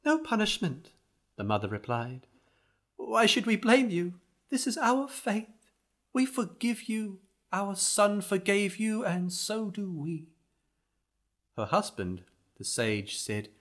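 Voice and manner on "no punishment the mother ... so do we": much higher, gentle mother voice; grieving mother. Acted.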